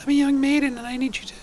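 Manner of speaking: light falsetto maiden; young maiden. Retold or imitated. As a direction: imitate a maiden coyly asking a favor. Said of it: "do you ever hear this sort of thing?".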